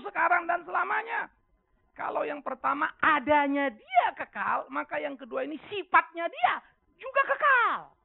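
high, loud, slowed preaching climax; preaching at full force. Acted. Pastor to congregation, preaching loud and high. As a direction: preach it out big, near a cry.

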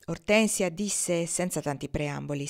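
ordinary delivery

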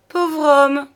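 high, loud mock commiseration; ironic pity. A narrator's reading. Juliette pities her lover's excuse, ironically. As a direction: exclaim in exaggerated false pity. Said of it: "d'un ton de pitié ironique".

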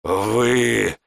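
slow gurgling threat through split lips; gurgling alien. A narrator's reading. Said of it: "пробулькал сектант разбитыми губами".

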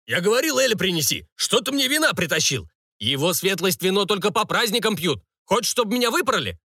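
high-pitched, agitated cook's rebuke; angry scolding. Acted. Cook berates kitchen boy over the wine, high angry scolding.

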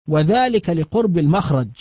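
ordinary delivery

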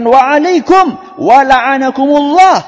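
loud, slow, furious retort; angry wife snapping back at the mockers; angry Aisyah. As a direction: hurl the curse back in anger. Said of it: "Aisyah ... marah dan beliau mengatakan".

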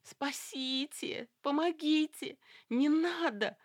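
high trembling cries for help; crazed with terror. A narrator's reading. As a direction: shake with panic as you cry out. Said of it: "тряслась ... обезумевшая от ужаса Люсьен". (в безумном ужасе)

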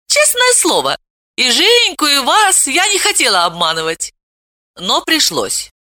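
put-on cheeky young girl. A narrator's reading. Veta's cheeky praise.

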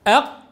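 أق cut dead on a hard ق; doubled qaf. Shown as a demonstration. Teacher drills the class, abrupt locked ق in أق. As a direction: slam the brakes on the ق of أق. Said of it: "مشددة".

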